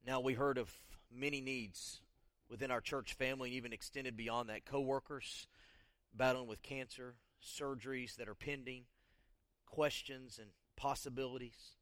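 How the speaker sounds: soft, subdued prayer-leading voice; guided congregational prayer. Acted. Pastor guiding the church to confess sins, soft prayer leading. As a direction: quietly lead them in prayer, with long pauses.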